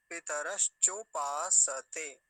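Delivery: पितरश्चोपासते loud and slow, long cho and paa; syllable by syllable. Shown as a demonstration. Teacher showing how the word is built, slow loud syllables. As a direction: punch out each syllable of पितरश्चोपासते. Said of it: "ऐसा बना".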